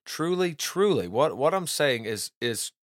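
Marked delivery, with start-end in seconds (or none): 0.0-1.1 both TRULY lifted high and stressed; emphatic truly. Shown as a demonstration. Teacher demonstrating Jesus' emphatic Truly, truly, stressed. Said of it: "it's for emphasis".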